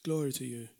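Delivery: low, soft murmur of praise; hushed prayer. Real, not acted. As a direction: drop the voice to address God privately. Murmured aside addressed to God.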